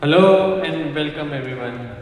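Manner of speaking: normal talking voice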